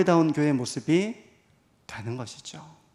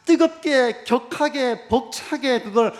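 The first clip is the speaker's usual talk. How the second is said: fervent; 7 semitones higher, 6 dB louder, slower than usual. Acted. Preacher stressing fervent love.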